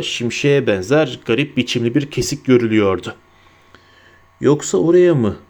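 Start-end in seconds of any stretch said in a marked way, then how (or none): none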